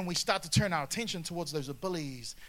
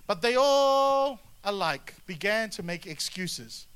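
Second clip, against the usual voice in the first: slow telling; 3 semitones higher, 7 dB louder, slower than usual. A narrator's reading. Slow dramatic reading.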